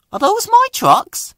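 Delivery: bright high toy engine voice; Stepney voice. Acted.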